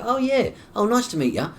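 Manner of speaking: high, loud put-on friendliness; eager greeting. Uncle greeting the young girl in his head, high and eager. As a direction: act out a bright, eager hello.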